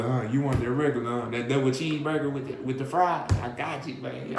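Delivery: playing a cheery cashier taking a regular's order; cheerful cashier. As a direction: sell it like the happiest cashier alive. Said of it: "You all happy and shit".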